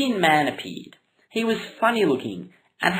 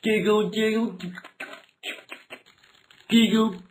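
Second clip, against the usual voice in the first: higher, odd chanted "Google, Google"; silly higher chant; mock googling chant; 6 semitones higher than usual. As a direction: voice the noise of googling. Imitates frantic googling noise.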